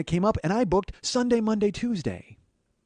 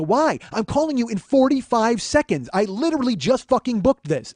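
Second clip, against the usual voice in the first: higher, louder furious complaint; angry; 8 semitones higher, 5 dB louder than usual. Retold or imitated. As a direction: let the outrage burst out at the agent. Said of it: "I'm mad".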